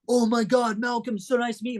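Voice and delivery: high, starstruck mock fan; put-on thrilled celebrity greeting; gushing fan.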